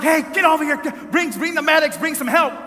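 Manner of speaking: loud, high re-enacted shout for help; urgent calling out. Traveler yelling to airport workers for medics, urgent. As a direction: holler commands across the room.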